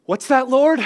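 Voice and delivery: high, loud cupped-ear call; hammed-up answering heaven; mock divine calling. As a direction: pretend to hear a voice from above. Pretending to hear God.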